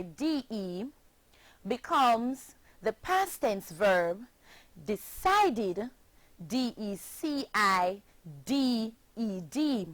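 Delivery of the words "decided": normal talking voice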